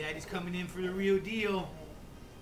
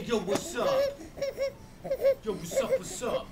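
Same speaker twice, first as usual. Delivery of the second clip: put-on baby voice.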